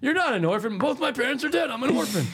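high-pitched, hammed-up protest; mock orphan quarrel. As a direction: act out an indignant orphan argument, pitched high. Acted quarrel about being an orphan.

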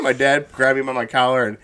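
ordinary talk, nothing marked

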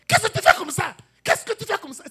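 very high, loud accusing shout; sharp shouted question. Shown as a demonstration. Preacher acting out a shouting wife for the congregation, loud accusing shout. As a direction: fling the question like an angry spouse. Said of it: "C'est différent de".

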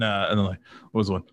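normal talking voice

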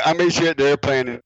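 ordinary talk

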